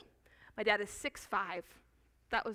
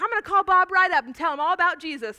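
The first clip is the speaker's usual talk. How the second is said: louder, higher and fast, imitating an overeager dad; excited dad; 7 semitones higher, 10 dB louder, faster than usual. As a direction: rattle it off like a dad bursting with zeal. Dad voiced, eager in story.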